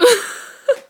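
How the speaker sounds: teary shriek of laughter; high laughing squeal. Real, not acted. Laughing fit.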